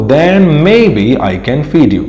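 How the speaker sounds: slow, careful English reading; slow teacher read-aloud. Shown as a demonstration. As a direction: read each word slowly for learners. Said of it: "teacher reads the English line slowly".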